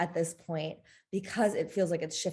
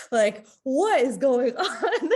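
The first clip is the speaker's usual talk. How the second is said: higher, louder, laughing through the question; laughing disbelief; 6 semitones higher, 7 dB louder, slower than usual. Real, not acted. Pregnant woman laughing at her hormonal chaos, amused disbelief. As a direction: laugh at the absurdity of it all.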